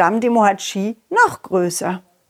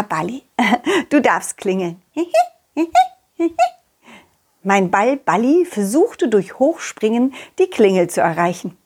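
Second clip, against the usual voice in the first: ordinary talk, nothing marked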